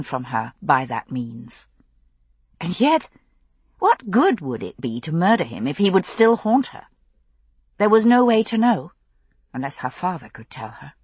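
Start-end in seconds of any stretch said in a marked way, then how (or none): none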